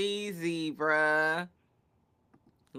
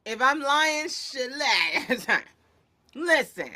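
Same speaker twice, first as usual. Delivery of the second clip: usual speaking voice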